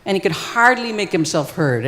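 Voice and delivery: ordinary delivery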